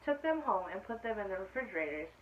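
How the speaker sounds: clear, lively storybook reading; reading to children. A narrator's reading. Teacher reading aloud to her class, storybook voice. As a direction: read it out to the class like story time. Reads picture book to class.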